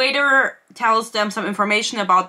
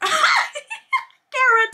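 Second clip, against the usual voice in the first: higher, laughing through the name. Real, not acted.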